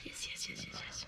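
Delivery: rapid hushed whispered yeses; whispering. Real, not acted. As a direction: whisper it urgently. Whispered encouragement.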